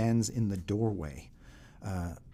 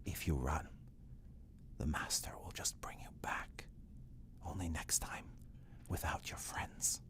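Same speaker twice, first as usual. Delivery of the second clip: low threatening whisper; menacing whisper; 4 semitones lower, 13 dB quieter than usual. Acted. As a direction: hiss it close in his ear. Escher leans close, threatens.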